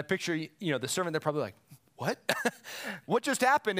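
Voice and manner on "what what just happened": high, incredulous mock surprise; comic high-pitched disbelief; acted bafflement. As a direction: play a stunned servant, laughing midway. Acting out the baffled servants.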